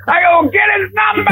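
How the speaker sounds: high, squeaky mock-panicked coach; hammed-up high panicked coach voice; flustered coach. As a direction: squeal it like a flustered guy who blew it.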